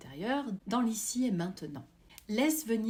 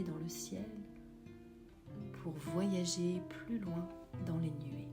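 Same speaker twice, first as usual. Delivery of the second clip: guided meditation; 7 dB quieter than usual. Acted. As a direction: guide them gently into calm.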